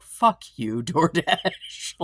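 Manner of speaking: loud curse breaking into laughter; laughing curse. Retold or imitated. Cursing at the DoorDash notification, laughing loudly. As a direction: swear at the app while laughing. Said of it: "And I'm like".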